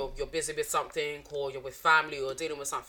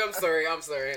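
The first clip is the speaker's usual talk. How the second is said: ordinary talk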